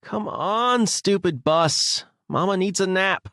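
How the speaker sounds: high, drawn-out tired pleading; sleepy whine. A narrator's reading. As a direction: plead like someone desperate for a nap. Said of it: "Anna yawned again".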